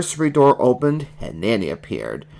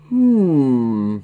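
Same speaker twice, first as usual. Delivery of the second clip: long, falling thoughtful hum; drawn-out thinking "hmm"; pondering hum; 4 semitones higher, 3 dB louder than usual. A narrator's reading. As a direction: hum it out in a frog character voice. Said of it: "hummed Kermit".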